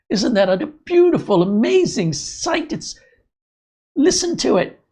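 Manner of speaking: an Australian tradesman in raptures over a clogged drain; excited Australian accent. Retold or imitated. Kate McKinnon doing a thrilled Australian drain cleaner, in a high, loud Australian accent. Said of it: "she starts doing the Australian accent".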